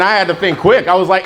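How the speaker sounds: ordinary talk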